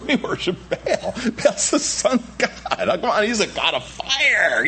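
high, mock-eager cult follower; Baal worshipper. Baal worshippers cheering themselves on about their fire god, high and mock-eager. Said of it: "what are they thinking".

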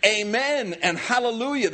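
high, emphatic proclamation; crying out praise. Acted. Preacher voicing elders at the throne, crying out. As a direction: call it out as worship. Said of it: "And they cried".

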